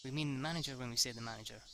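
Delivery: plain reading voice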